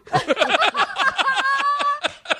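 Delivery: squealing laughter; long high laugh. Real, not acted. Host laughing at his own story, long high laugh. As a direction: crack up fully.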